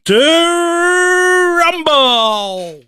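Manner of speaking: high, loud, drawn-out showman's holler; ring-announcer shout. Acted. Host kicks off the show, ring-announcer shout. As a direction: stretch the words like a boxing announcer, swooping on the vowels.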